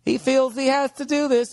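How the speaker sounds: high, far-off mocking mom voice; mother. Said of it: "his mother is just sitting there going like".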